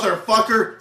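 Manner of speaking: loud, high mock curse; heroine's kill line. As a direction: punch it out as a movie one-liner.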